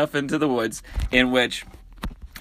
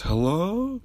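higher, soft mock Southern hello; a light sweet phone hello; sweet Southern lady; 7 semitones higher, 3 dB quieter than usual. Imitating the campground lady answering the phone, lightly sweet. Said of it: "very sweet Southern drawl toting lady".

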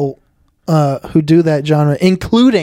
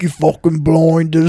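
mock British accent.

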